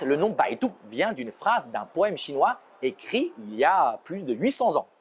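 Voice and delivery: usual speaking voice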